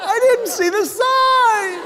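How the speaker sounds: high, slow, shouted "There's a hole!"; hammed-up panic at a tunnel; panicked dim driver. Comic voicing a clueless driver shouting at the tunnel in mock panic. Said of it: "Who is driving going".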